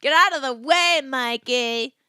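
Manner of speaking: loud, high, over-the-top star; hammed-up diva. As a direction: belt it out like a pushy star.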